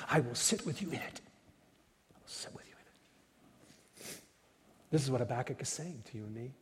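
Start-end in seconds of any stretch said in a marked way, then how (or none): 2.1-4.2 slow breathy whisper; whispered repeat. Shown as a demonstration. Preacher repeating the promise to listeners, whispered slowly. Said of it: "repeated phrase, second whispered".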